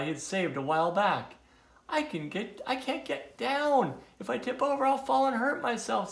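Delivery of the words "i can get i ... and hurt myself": high-pitched, anxious character voice; mild storybook panic; worried turtle. A narrator's reading. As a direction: plead in a high, helpless voice. Stuck turtle frightened of falling.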